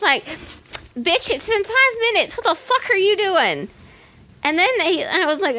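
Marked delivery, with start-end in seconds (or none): 1.0-3.7 mock scolding. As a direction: play the fed-up ticket taker, pitched up. Ticket taker scolding her.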